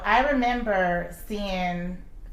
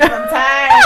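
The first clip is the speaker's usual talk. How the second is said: a loud, much higher cry of exhaustion; mock exasperated outburst; 13 dB louder than usual. At the lunch with her friend, a loud mock cry. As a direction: let it burst out in exaggerated frustration. Inner thought during lunch.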